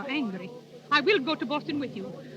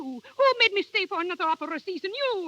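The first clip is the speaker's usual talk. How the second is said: rising angry accusation; 4 semitones higher, 5 dB louder than usual. Acted. Singer accusing manager, building.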